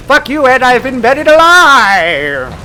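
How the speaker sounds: loud, high, slowed mock yell into a voicemail; retold angry shout. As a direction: bellow it like a furious buried man. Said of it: "And he says".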